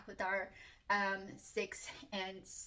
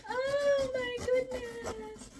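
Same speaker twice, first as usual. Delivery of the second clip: high, slow, near-tearful awe; overwhelmed gasp; 13 semitones higher, 5 dB louder, much slower than usual. Real, not acted. Vlogger overwhelmed on opening the box, high and near tears. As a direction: let it come out almost crying with joy.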